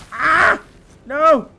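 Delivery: loud, high yelp of pretend alarm; mock-terrified cry. Startled player facing spiders in a game, mock-terrified cry. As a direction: cry out as if ambushed, playing it up.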